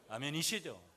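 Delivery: ordinary delivery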